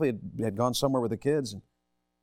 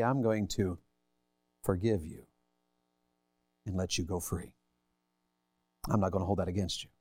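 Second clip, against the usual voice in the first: tender forgiveness; 7 semitones lower, 6 dB quieter than usual. Acted.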